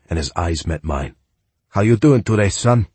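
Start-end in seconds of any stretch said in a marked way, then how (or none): 1.7-2.9 put-on friendly greeting in a heavy Spanish accent; heavy Spanish accent, higher and louder. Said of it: "with a heavy Spanish accent".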